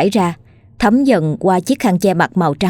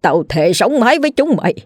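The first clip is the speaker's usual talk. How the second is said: shouting at ghosts. A narrator's reading. Grieving father yells at the laughing ghosts, furious defiance. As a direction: hurl defiance at the mocking spirits. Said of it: "ông quát lên".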